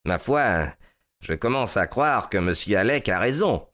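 ordinary delivery, nothing marked

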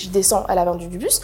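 ordinary talk

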